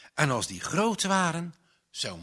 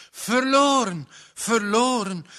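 loud, high, desperate outcry on each verloren; despairing cry; 8 semitones higher, 7 dB louder than usual. Acted. Drowning boy realises he is lost, loud anguished cry. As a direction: fling it out in utter despair. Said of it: "zo vlamde het".

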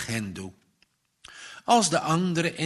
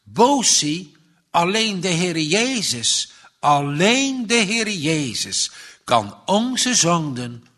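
emphatic insistence, "Bosi" high and loud; 3 semitones higher, 5 dB louder than usual. A narrator's reading. Child telling Bosi who forgives sins, emphatic. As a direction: lean firmly on "alleen de Heer Jezus". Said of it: "Als hij nadrukkelijk zegt".

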